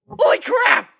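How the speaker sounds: sudden high loud yelp of shock; startled yell. Acted. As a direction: jump out of your skin and yell. Startled by eagle on waking.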